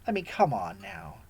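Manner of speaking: ordinary talk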